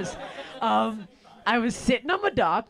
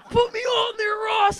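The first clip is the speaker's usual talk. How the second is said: excited shout; 11 semitones higher than usual. Retold or imitated. Comic to Ross about tubing, a high eager shout. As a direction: yell it with glee. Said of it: "So I'm like".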